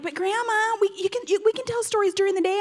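high-pitched imitation of a begging kid; pleading grandchild. Grandkids beg Grandma for daytime stories, mock pleading. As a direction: plead like a child wheedling Grandma.

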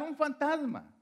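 high-pitched retold cry of alarm; imitated frightened shout. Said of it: "todos gritaban".

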